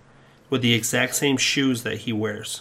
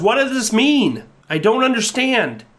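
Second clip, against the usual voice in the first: high, raised-voice demand; exasperated plea; 11 semitones higher, 4 dB louder than usual. Acted. Customer demands answers from fortune teller machine, high exasperated cry.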